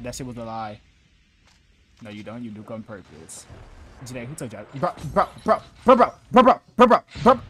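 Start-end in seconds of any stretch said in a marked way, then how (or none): 4.8-7.4 frantic repeated yelling, much higher and louder; panicked shouting. Real, not acted. Streamer yelling at a teammate mid-fight, panicked. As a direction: yell it in a panic as the fight turns.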